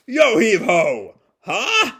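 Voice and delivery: slow, hammed-up heave-ho yell; playful shouted heave ho; mock shout. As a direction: belt it like a dad heaving a table. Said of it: "Yohei shouts at him".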